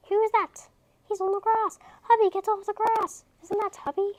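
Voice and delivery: excited. Acted. Excited spotting in story.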